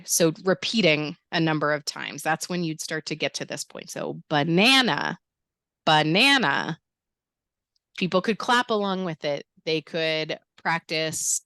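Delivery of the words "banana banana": "banana" with a loud, drawn-out middle, ba-NA-na; emphasized stress. Shown as a demonstration. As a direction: punch the middle syllable of "banana". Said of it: "while really emphasizing the stress".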